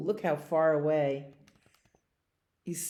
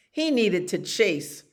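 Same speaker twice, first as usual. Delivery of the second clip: usual speaking voice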